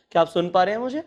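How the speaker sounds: normal talking voice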